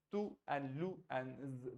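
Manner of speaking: "Tou and lou and z" broken into slow parts; syllable by syllable. Shown as a demonstration. Teacher breaks the city name into syllables. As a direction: split Toulouse into its sounds. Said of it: "and then Toulouse".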